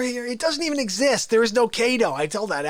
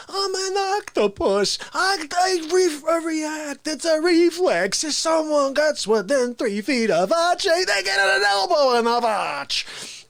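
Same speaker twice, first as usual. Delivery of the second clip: high, slow, self-important voice; Fauci excuse; 6 semitones higher than usual. Retold or imitated. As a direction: explain the reflex like it is obvious. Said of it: "And you say".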